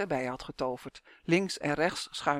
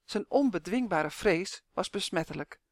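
usual speaking voice

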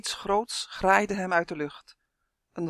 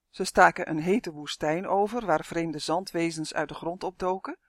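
ordinary delivery

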